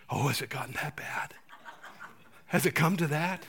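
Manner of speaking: whispered, mock-worried aside; hushed mock alarm. Preacher mocking a friend's dismay at prayer, hushed whisper. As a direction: breathe it like grim news.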